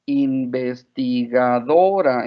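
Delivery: INVESTIGADORA stretched slowly across its many syllables; drawn-out long word. Retold or imitated. Mother showing how long the word sounded to her daughter, "Investigadora" drawn out slowly. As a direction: draw out "Investigadora" as one huge long word. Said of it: "seven syllables".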